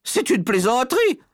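shrilly put-out lady; huffy, pinched indignation. Acted. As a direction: say it very high, stiffly offended. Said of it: "ajouta madame Jenkins d'un air pincé".

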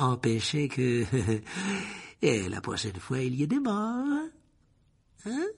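silky menace toward the headmaster; Lucius Malfoy voice. A narrator's reading. Malfoy serves Dumbledore a suspension, coolly superior.

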